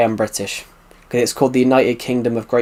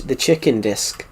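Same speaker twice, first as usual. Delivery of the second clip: slowed New Zealand vowels, "chicken disc"; imitated New Zealand pronunciation of "chicken disc"; New Zealand accent; 3 semitones lower, 6 dB quieter than usual. Shown as a demonstration. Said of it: "it sounds like a chi".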